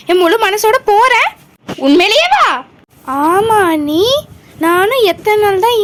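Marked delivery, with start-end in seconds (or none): none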